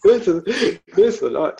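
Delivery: loud, high Australian "This is a knife"; mock Australian quote. Friend echoing the movie line, loud mock Australian. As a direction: punch "This is a knife" like Dundee. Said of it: "Greatest line ever in filmography".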